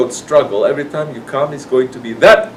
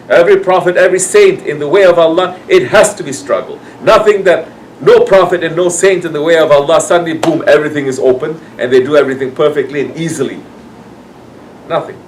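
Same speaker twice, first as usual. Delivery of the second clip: loud, raised, emphatic sermon delivery; forceful preaching; 4 semitones higher, 5 dB louder than usual. Real, not acted. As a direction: drive each point home to the congregation.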